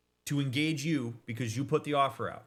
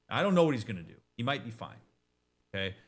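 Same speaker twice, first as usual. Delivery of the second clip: ordinary delivery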